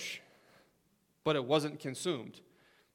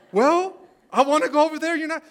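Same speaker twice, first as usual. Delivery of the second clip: much higher and louder, laughing through a mock Balaam; hammed-up Balaam; 13 semitones higher, 14 dB louder than usual. Preacher voicing Balaam arguing with his donkey, hammed-up and laughing.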